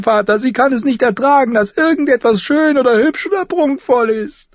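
put-on very high, loud girl's complaint; eager, indignant accusation. Sansa complains to father about her sister, indignant. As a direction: pile up the grievances in a high, loud voice. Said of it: "sagte Sansa eilig, eifrig darauf bedacht".

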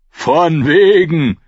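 slow, loud, high scoff; drawn-out shout of refusal; defiant shout. Acted. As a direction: throw it back loudly in contempt. Micken defies Theon's claim.

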